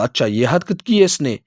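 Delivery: usual speaking voice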